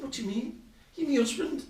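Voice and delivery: high-pitched mock indignation; imitated indignant wife. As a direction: retort as the put-out new wife. Wife retorts in acted argument.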